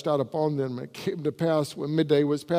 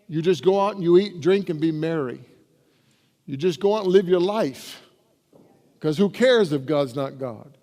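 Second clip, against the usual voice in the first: raised, louder preaching push; 2 semitones higher, 4 dB louder than usual. Acted. Preacher warning his congregation, in a raised, louder preaching voice. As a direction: preach it hard at the crowd. Preacher mocks careless living.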